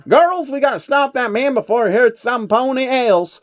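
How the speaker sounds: high rallying cowgirl call; rally the girls to chase him; Applejack. Acted.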